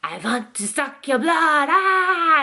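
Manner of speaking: hammed-up vampire pounce with a yell; mock vampire. Mom pretending to be a vampire at bedtime, slow spooky voice. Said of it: "all of a sudden I'd hear this".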